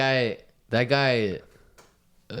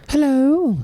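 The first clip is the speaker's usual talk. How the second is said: high, loud secretary's phone greeting; old secretary; 7 dB louder than usual. Acted. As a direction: put on a piercingly high old-secretary hello. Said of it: "like a old secretary".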